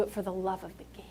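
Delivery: hushed, breathy punchline; whisper. Retold or imitated. Motivator sealing his speech to the crowd, whispered. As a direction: drop to a whisper to land it.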